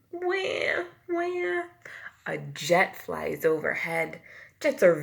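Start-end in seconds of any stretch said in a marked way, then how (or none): 0.1-1.6 high whispered wah wah; a tiny baby cry, whispered. A narrator's reading. Teacher imitates a baby crying, high and whispered. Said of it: "A baby crying".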